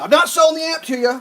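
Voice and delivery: high, loud imitated shout; retold yell. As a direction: yell it like a defensive seller. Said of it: "Like, he yelled that".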